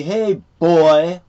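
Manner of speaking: high, very loud mock holler; retold calling out. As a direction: imitate someone hollering at a person. Retelling an insulting call.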